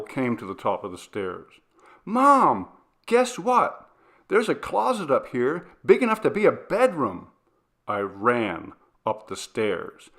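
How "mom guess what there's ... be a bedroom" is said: put-on high, loud thrilled kid's voice; bursting with a discovery; excited child. A narrator's reading. Daughter calling down to her mother, high excited voice.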